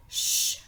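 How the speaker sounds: "ch" as a voiceless, sustained shush; isolated CH sound. Shown as a demonstration. Teacher revealing the repeated sound, "ch" as a long shush. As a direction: sound out "ch" alone, not as letter names. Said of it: "les lettres qui font le son ch".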